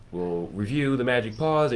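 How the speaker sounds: ordinary delivery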